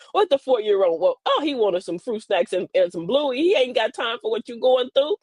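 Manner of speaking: usual speaking voice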